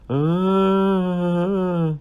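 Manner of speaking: high, loud imitated cry of pain; imitated scream of pain. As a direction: mimic her scream from the hospital bed. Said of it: "in the background screaming".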